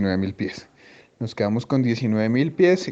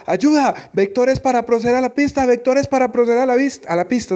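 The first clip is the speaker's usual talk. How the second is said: much higher, pleading imitation of a frantic pilot; desperate pilot; 11 semitones higher than usual. Pilot begging controller for vectors, desperate high voice. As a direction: beg for vectors like a pilot in panic. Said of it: "así la voz desespera".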